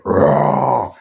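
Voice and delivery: short rough roar; imitated bear roar. Fan replaying the bear's answer, short roar. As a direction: roar back briefly like a bear. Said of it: "when the bear went".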